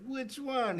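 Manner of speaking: high, soft, lightly dopey question; lightly acted childlike voice; simple-minded brother. Plays brother not all there.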